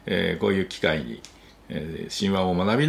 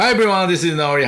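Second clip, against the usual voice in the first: excited greeting; 11 semitones higher, 8 dB louder than usual. Real, not acted. Bright podcast greeting.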